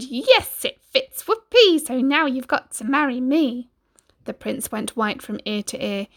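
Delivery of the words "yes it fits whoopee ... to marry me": high, loud triumphant shriek; gleeful scream. Acted. Ugly sister gloating to the prince, high loud shriek. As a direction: shriek with greedy joy. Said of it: "But she screamed".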